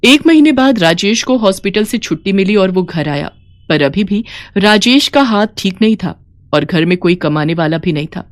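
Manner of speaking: usual speaking voice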